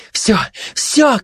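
high-pitched exclamation; crying out. A narrator's reading. Glutton has already swallowed the prize, crying out. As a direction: cry out high to end the fight. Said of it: "кричала обжора".

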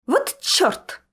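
high-pitched vexed curse; heartfelt exasperation. A narrator's reading. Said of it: "с чувством сказал Альберт Джоплин". (с чувством, досадливо, высоким голосом)

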